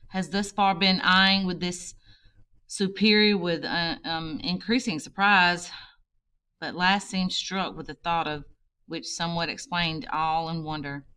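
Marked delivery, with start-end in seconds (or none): none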